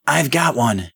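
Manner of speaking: acted yell at normal volume. A narrator's reading. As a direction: call out to the team. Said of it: "yelled Miss Patterson".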